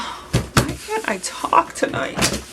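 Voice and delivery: slow, high, airy whine; drawn-out half-whispered lament; exasperated self-complaint. Real, not acted. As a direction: moan it in weary frustration. Frustrated at repeated flubs.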